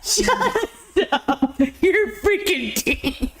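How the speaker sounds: high, laughing protest; cracking up. Real, not acted. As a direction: tell them to stop through helpless laughter. Cracking up at co-host's impression.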